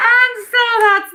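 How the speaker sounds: high, loud rising giggle into words. Real, not acted. Psychic giggling about man versus minute typo. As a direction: giggle helplessly at the mix-up.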